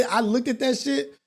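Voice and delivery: ordinary talk, nothing marked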